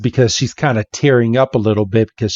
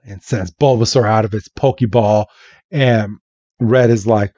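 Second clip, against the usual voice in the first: usual speaking voice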